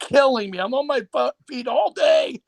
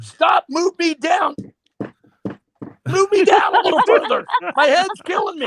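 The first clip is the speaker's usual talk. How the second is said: acted complaint; 6 semitones higher than usual. Acts out bedroom complaint.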